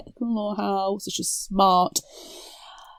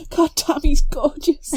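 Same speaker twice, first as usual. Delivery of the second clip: mock swooning; 8 semitones higher, 4 dB louder than usual.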